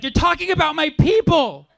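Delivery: high mock-indignant woman; outraged heckler. Heckler defends her people, high mock outrage. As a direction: pitch up and protest in a put-on voice. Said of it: "She's like".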